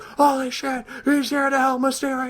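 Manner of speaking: high, mock excited crowd yell; hyped crowd. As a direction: squeal like a fan who can't believe it. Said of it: "everyone's like".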